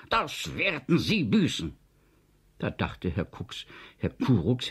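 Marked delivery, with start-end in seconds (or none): 0.1-1.7 high-pitched angry threat; fauchend. A narrator's reading. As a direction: spit it out as a threat. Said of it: "fauchte Herrn Kurux". (wütend gefaucht, mit hoher Stimme)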